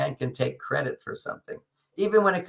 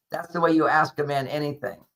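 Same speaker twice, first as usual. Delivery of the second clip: ordinary talk, nothing marked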